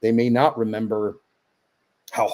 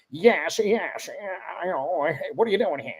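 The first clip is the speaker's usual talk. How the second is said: higher put-on comic character voice; couple of guys; 5 semitones higher, 4 dB quieter than usual. Shown as a demonstration. Dad showing off his different storybook voices, higher comic guy. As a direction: put on a goofy higher voice, softer at times. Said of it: "a couple of guys like".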